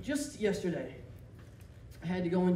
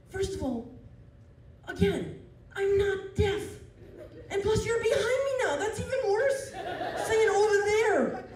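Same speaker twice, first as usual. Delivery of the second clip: high, loud, exasperated rant; indignant rant; 12 semitones higher, 3 dB louder than usual. Retold or imitated. Comic telling off the worker for shouting from behind, high and laughing. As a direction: vent at her in disbelief, laughing.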